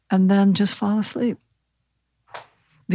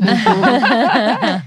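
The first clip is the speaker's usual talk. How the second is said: short amused laugh after the punchline; 4 semitones higher, 3 dB louder than usual. Real, not acted. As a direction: chuckle at the joke. Laughing at joke.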